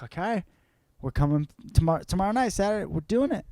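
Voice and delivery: excited. Retold or imitated. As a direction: blurt it out, thrilled to book tickets. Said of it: "I'm like".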